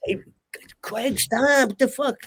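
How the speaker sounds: high-pitched, urgent stop-that; alarmed hiss of protest. Retold or imitated. To Craig at the blackboard, a high-pitched, startled rebuke. Friend wrote a swear on the blackboard.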